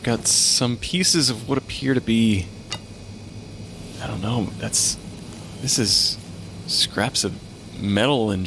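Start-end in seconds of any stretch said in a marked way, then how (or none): none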